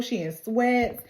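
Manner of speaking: ordinary talk, nothing marked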